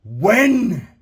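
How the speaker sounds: God calls out with force; loud, lifted win; ringing command. Acted. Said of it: "And God said".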